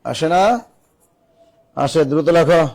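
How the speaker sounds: usual speaking voice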